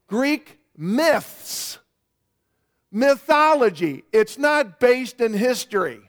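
preacher driving the point in; emphatic preaching. Real, not acted. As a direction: hammer each denial home with pauses.

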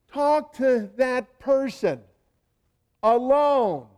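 high, loud and slow, each word hammered; emphatic command. Real, not acted. Preacher urging the congregation, loud and slow. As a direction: drive the command home to the church.

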